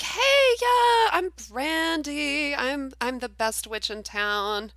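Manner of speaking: high, slow, sing-song witch intro; enchanting witch Brandy. Acted. Brandy introducing herself to strangers, high and slow. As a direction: charm them with a high drawn-out hello. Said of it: "she's like".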